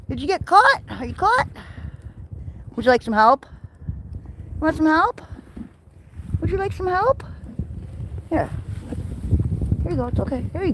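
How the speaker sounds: high, lilting concern; coaxing pet-talk. Real, not acted. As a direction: gently offer help to a stuck little creature. Asking a stuck baby goat.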